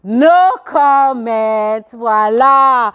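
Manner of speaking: drawn-out, singsong and playful; playful teasing. Real, not acted. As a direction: stretch each word out teasingly. Playful refusal after the laugh. (ton taquin, syllabes étirées)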